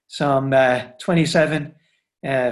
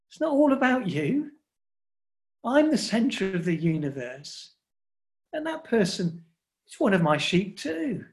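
higher-pitched, softly admonishing aside; gentle telling-off; 5 semitones higher, 5 dB quieter than usual. Acted. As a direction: take someone aside and gently set them straight. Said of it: "takes me on one side and he says".

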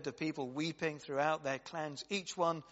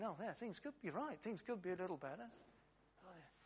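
muttered agreement; 3 semitones higher, 12 dB quieter, faster than usual. Retold or imitated. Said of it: "someone else is thinking".

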